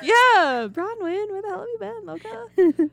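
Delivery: high-pitched sing-song call to a long-lost friend; mock greeting to Bronwyn. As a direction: squeal it up high like welcoming back a friend.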